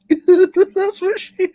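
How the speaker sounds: high, slow, laughing words. Real, not acted. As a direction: laugh through it. Laughing while speaking.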